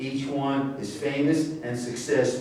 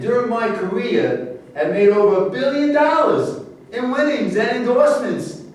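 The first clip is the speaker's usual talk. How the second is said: proud boast; 10 semitones higher, 7 dB louder than usual. Acted. Boasting about his fortune.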